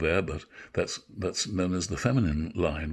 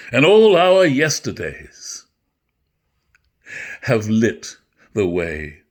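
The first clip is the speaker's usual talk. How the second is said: big, slow stage delivery, swelling louder; dramatic Shakespeare recitation; 3 semitones higher, 7 dB louder, slower than usual. Acted. Actor performing Macbeth's speech for listeners, full stage voice. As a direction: declaim it to the back of the theatre.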